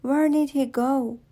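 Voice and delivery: ordinary delivery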